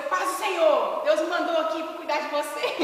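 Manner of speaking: loud, eager mock greeting breaking into a laugh; hammed-up zealous believer announcing himself; saudação fervorosa. Retold zealous roommate greets him. (saudação de crente empolgado, alta e exagerada)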